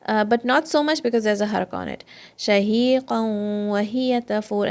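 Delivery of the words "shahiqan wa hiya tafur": Shahiqan wa hiya tafur drawn out slowly; slow recitation. Shown as a demonstration. Teacher models the ayah slowly for students. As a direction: recite each letter from its makhraj. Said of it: "some air also comes".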